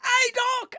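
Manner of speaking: very high shouted greeting in a put-on voice; bad Ollie impression. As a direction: squeal the name from across the map. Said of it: "I can't do an Ollie voice".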